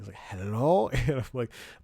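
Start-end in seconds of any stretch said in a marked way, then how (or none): 0.2-0.9 sleepy. Retold or imitated. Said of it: "he's, like, sleeping".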